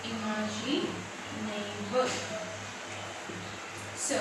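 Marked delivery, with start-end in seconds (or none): 0.1-2.1 slow reading. Shown as a demonstration. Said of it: "teacher models the word".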